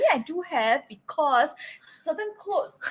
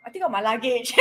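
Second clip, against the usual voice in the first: usual speaking voice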